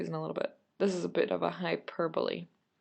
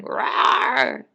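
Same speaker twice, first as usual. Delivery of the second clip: mock scary roar, not loud; monster roar; 6 semitones higher, 8 dB louder than usual. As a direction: give a playful haunted-house growl. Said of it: "here they are going".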